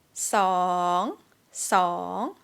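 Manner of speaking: scoop song down then up; Thai rising tone. Shown as a demonstration. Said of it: "the sweetest tone".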